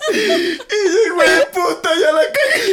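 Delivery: high laughing wail of embarrassment. Retold or imitated. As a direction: blurt it out while laughing hard. Retelling his embarrassment, cracking up.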